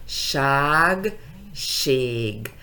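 long held vowels in "Ság, ség"; stretched vowels. Shown as a demonstration. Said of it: "estiro la vocal".

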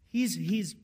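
normal talking voice

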